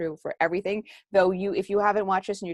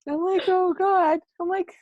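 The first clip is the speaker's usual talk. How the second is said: much higher, louder, laughing through happy tears; overwhelmed and laughing at her own tears; teary laughter; 12 semitones higher, 6 dB louder than usual. Real, not acted.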